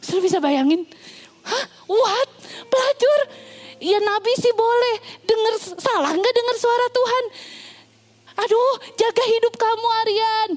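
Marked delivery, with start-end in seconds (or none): 1.4-10.5 high, startled gasp of disbelief; shocked crowd. Acted. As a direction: act the scandalised church members. Congregation shocked at the news.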